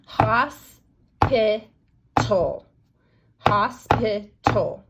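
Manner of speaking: Hospital drawn out slowly, syllable by syllable; slow syllable stretch. Shown as a demonstration. Teacher modelling syllables for students, slow and loud. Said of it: "Hos is my first syllable".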